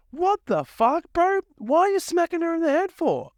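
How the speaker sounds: high, appalled protest at the screen; incredulous outrage. Retold or imitated. Viewer scolding Prince on screen, high and appalled. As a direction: squeal it out in disbelief. Speaker retells his own outrage.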